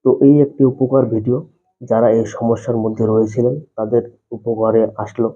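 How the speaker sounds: ordinary delivery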